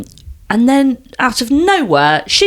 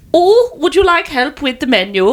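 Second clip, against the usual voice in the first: put-on German accent drifting towards Dutch, pitched up; higher, mock Dutch-German accent; Dutch-sounding accent; 7 semitones higher than usual. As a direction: imitate a helpful woman with a Dutch-tinged accent. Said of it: "That sounded more sort of".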